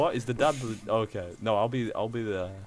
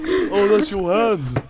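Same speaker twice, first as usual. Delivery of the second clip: slow high surprise; 8 semitones higher, 7 dB louder, slower than usual. Acted. Uncle reacting in scene.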